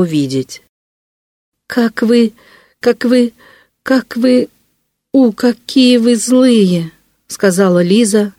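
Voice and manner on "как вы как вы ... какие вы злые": put-on higher, halting, tearful child's reproach; choking back tears. A narrator's reading. Girl reproaches the man, choking back tears. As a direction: break it into gasped starts, then draw out the accusation. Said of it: "задыхаясь от подавляемых слёз".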